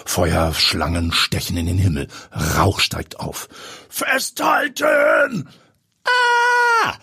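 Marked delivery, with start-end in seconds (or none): 3.9-5.5 urgent shout. Acted. Pilot warns as plane swerves. 6.1-6.9 high, loud shriek of fright; Eva screaming as the plane swerves; short scream. Acted. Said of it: "Eva Engel schreit auf".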